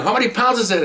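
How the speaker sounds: high, eager question; excited chief. Retold or imitated. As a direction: mimic a chief thrilled by his big bust. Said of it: "he was so excited".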